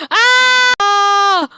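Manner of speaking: long, high scream; drawn-out high cry of alarm; frightened scream. Acted. Ghost appears, player screams.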